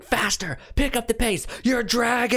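high-pitched drill-instructor style yell; hammed-up, high yelled orders; mock yelling. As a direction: imitate someone yelling at a drummer to speed up. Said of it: "yelling at".

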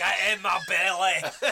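slow, drawn-out comic Scottish impression; Mike Myers. Player mimicking a film star's Scottish catchphrase, slow comic impression. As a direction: stretch it out in a put-on comic voice. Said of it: "this is Mike Myers style".

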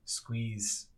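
ordinary talk

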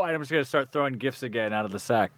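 ordinary delivery

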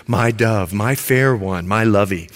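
low, syrupy mock sweet talk; mock lovey-dovey. Preacher teasing the men about poetic talk, mock sweet and low. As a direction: ham up a gushing lover. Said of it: "if we talk like this".

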